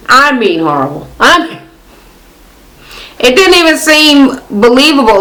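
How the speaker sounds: ordinary delivery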